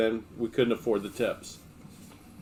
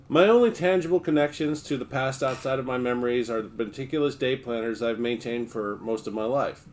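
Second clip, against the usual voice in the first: normal talking voice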